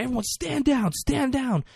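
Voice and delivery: high, commanding call; high mock command; shouted order. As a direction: bark the order to the soldiers. [name] ordering soldiers.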